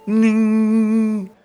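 high, loud, held humming note; tuning fork ring. A narrator's reading. Tuning fork struck before the song, ringing. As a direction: ring out on one steady pitch. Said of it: "zei de stemvork".

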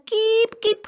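high, loud chicken call. A narrator's reading. Boy running after the animal, calling out.

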